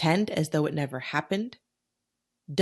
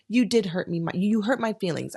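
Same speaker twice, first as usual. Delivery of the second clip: ordinary talk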